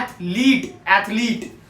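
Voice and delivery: "एथलीट" as ath-LEET, long ee at the end; correct pronunciation. Shown as a demonstration. Teacher gives learners the actual pronunciation, correct "एथलीट" with long ee. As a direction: stretch the second syllable of "एथलीट" to leet. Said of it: "इसकी actual में pronunciation है".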